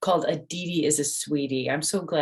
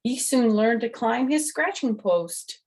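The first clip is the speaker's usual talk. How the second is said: much higher and louder, cheerful storybook voice; reading to children; 7 semitones higher, 4 dB louder than usual. A narrator's reading. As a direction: announce it proudly to the little listeners. Reads the picture book aloud.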